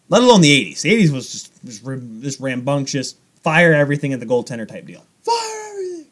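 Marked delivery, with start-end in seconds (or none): 5.2-6.1 tiny, very high mock command; squeaky battle cry. Goalie echoing his shooting phrase, high mock battle cry. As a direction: squeal it softly like a far-off order. Echoes own phrase in silly voice.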